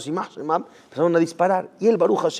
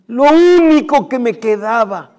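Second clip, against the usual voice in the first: anguished; 7 semitones higher, 9 dB louder, slower than usual. Acted. Father's anguished cry.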